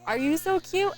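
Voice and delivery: high, loud sing-song parentese; baby talk. Retold or imitated. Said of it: "they're like".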